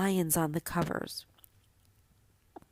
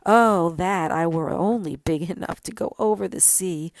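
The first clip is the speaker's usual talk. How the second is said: longing cry; 7 dB louder than usual. Acted. Tree longs to travel.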